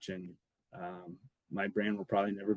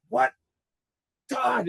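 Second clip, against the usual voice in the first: sharp yelled question, pitch jumping far higher; retold yell; 14 semitones higher, 5 dB louder than usual. Coach looks around and yells for Todd, loud and alarmed. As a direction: coach shouting the name in disbelief. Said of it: "And he starts yelling".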